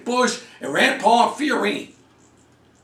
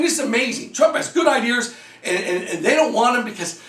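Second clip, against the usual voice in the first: ordinary talk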